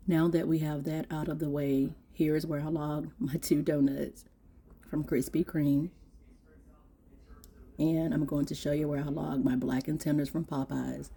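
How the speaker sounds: low, soft explaining voice; quiet walkthrough. Real, not acted. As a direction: talk it through quietly.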